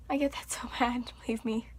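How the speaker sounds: soft whispered aside with a laugh. Real, not acted. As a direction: confide it under your breath. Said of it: "unvoiced whisper".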